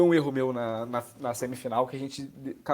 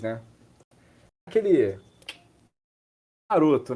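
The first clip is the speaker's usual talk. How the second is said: normal talking voice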